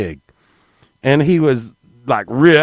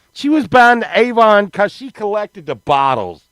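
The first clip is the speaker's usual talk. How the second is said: normal talking voice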